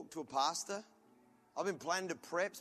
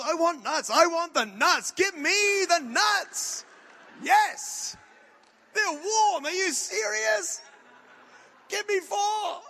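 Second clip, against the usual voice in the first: very high, loud, slow shout for nuts; begging wildly for the warm nuts; greedy nut frenzy; 10 semitones higher, 10 dB louder, much slower than usual. Retold or imitated.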